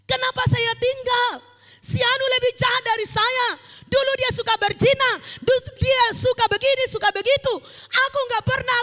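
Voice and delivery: loud, high-pitched mock complaint to God; hammed-up indignant wail; aggrieved protest. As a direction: cry out against an unfair verdict, in acted outrage. Acted protest to God at judgment.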